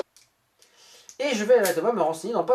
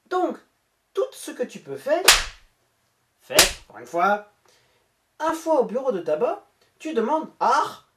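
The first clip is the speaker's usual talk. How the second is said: a put-on German accent, pushed louder toward the end; 4 semitones higher, 5 dB louder than usual. Scammer's message to the YouTuber, read in a mock German accent. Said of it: "j'ai repris mon accent allemand".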